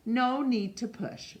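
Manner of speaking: ordinary delivery, nothing marked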